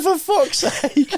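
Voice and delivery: very high retold squeal of dismay; mock exasperation. Passenger retelling his parachute worry, high mock exasperation. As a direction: squeak it out in comic despair. Retold reaction to pilot's reassurance.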